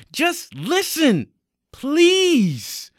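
high, loud, slow begging; desperate plea. Acted. Threatened young man begging officer to listen, desperate plea. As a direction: stretch the words out in a loud plea.

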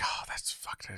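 very soft whispered aside; imitated whisper. Imagining the princess after she finishes the letter, mock whisper. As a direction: whisper it as the character's private reaction.

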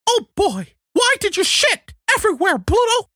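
very high cartoon voice asking Pluto why he made a mess; goofy cartoon voice. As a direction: play a silly cartoon character scolding his dog. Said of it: "take known characters they like and make them say".

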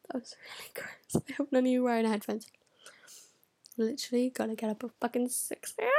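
usual speaking voice

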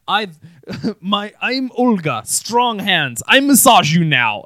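강한 러시아 억양, 크게; 힘센 마사지사 흉내, 점점 더 크게; Russian accent. Retold or imitated. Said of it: "she was like".

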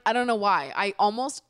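ordinary talk

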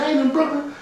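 high, soft disbelief; surprised realization. Retold or imitated. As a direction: catch yourself in disbelief. Realizing wrong borough.

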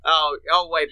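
ordinary talk, nothing marked

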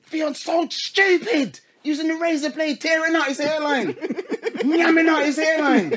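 high, slow, shouted mum rant; mum cussing. Retold or imitated. Imitating mum cussing him on the phone to his auntie, high and slow. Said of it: "cussing me, saying".

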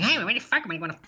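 high-pitched mock Boston rant; hammed-up Boston tough, pitched high; Boston guy ranting. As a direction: imitate a Boston guy losing it. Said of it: "the Boston guy going".

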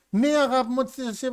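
high-pitched mock compliant wife; Brandy. Wife indulging grieving husband, high mock agreeableness. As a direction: agree sweetly to whatever he wants. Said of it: "πολύ αδιάφορος χαρακτήρας".

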